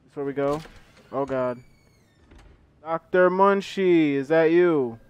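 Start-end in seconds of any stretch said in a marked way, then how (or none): 2.8-5.0 loud drawn-out call. Acted. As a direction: call out slowly and loudly. Calling out to game character.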